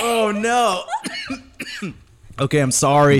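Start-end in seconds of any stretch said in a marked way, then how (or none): 0.9-1.9 a laughing chesty cough. Real, not acted. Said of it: "sorry for coughing in everyone's ears".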